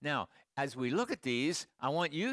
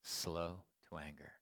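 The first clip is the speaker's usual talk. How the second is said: moved, hushed reading; 9 semitones lower, 8 dB quieter than usual. Retold or imitated. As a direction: imitate her reading it softly and low. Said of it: "really got to her".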